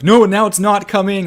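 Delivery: mock denial. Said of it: "yesterday being like".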